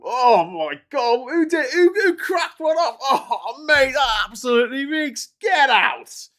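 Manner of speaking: high, loud, mock-disgusted yelling; hammed-up wail then a loud shout, as if someone broke wind; outraged shout. Undiplomatic politician reacting to a smell, loud mock outrage. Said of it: "that would be an hilarious response".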